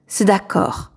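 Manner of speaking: ordinary delivery, nothing marked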